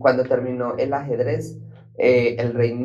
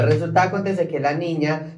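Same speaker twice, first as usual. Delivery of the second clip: ordinary delivery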